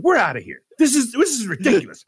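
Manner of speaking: acting out an indignant walkout; retold fed-up outburst. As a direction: announce it in worked-up exasperation. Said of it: "I had a lot of pressure on me".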